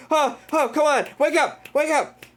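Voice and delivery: high, imploring mock cries; urgent panic. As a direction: urgently try to rouse someone, acted. Retold hypnotist urgently waking subject.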